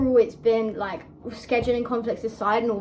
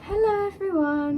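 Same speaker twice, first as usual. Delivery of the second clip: polite secretary-like hello; sincere greeting; 9 semitones higher than usual. Acted. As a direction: greet them like a receptionist. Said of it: "what was that so sincere?".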